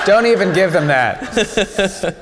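slow words through laughter; laughing. Real, not acted. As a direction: laugh while you say it. Host laughing at joke.

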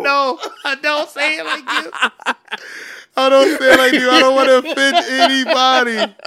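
high, loud, laughing denial; laughing protest. Real, not acted. Teasing back at his friend's impression, laughing loudly. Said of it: "You say arigato like we say arigato".

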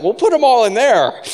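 high and loud, thrilled; excited kids. Retold or imitated. Kids cram the chickens into the shed, high and loud with excitement. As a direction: kids cheering over their new chickens.

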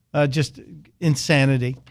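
ordinary talk, nothing marked

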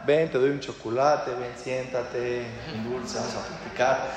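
soft, low, coaxing voice; soothing teacher. Acted. Teacher soothing a frightened pupil, soft and low.

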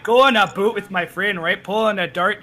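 Canadian accent verging on Scottish; exaggerated Canadian, nearly Scottish; thick Canadian. Shown as a demonstration. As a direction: lay on a hammy Canadian accent. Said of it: "becoming so Canadian, you become Scottish".

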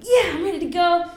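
a fish just hit the line, eager excited cheer. Retold or imitated.

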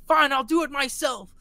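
high, loud defiant line; defiant shout. Retold or imitated. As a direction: bark it out defiantly. Voicing Reagan defiant.

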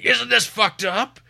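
high, drawn-out mock scream. Co-host imitating the movie's constant shouting, screaming. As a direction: yell it like an overwrought movie character. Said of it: "someone screaming".

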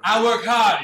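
loud shout across the room. Retold or imitated. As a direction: yell it to someone far away. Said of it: "he just, like, shouts to the other person".

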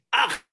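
short, high "aj" cry; sudden high squeal of alarm, mimicked; imitated wife's scream. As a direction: mimic a startled yelp. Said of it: "mi esposa grita".

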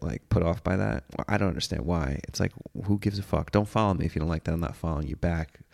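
ordinary talk, nothing marked

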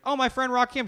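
high-pitched retold delight; eager claim. Husband claims the rap star as his friend, eager imitation. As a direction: pipe up as if greeting a pal. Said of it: "He's like".

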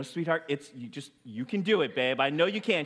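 gently coax, holding back the scream; calm voice. Retold or imitated. Dad encouraging daughter while screaming inside, forced calm. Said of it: "I'm using my calm voice".

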